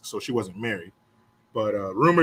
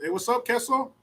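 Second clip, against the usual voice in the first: higher-pitched schoolkid greeting; teasing kids; 8 semitones higher than usual. Retold or imitated. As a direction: holler hello like a cheeky kid. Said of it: "All the Mexican kids".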